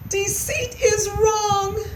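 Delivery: high, slow squeaky voice; drawn-out mousy confession; remorseful mouse. A narrator's reading. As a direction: admit it slowly in a small voice. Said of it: "he said for the second time".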